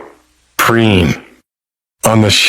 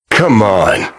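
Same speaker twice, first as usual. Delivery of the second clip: usual speaking voice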